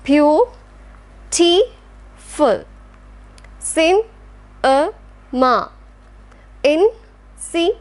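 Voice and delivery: beau, ti, ful, one beat at a time; broken into syllables. Shown as a demonstration. Teacher splitting a word for students. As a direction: spell it out in slow beats. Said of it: "How can we, uh, cut this word beautiful into its syllabus?".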